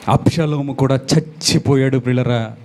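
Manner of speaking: ordinary talk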